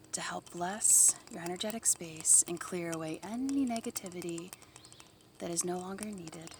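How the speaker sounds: soft voice fading to a whisper; soft spa whisper. Acted. Guided blessing, fading to whisper.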